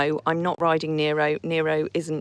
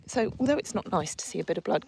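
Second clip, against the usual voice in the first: usual speaking voice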